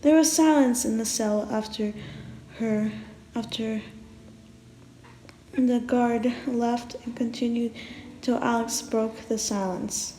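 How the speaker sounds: ordinary delivery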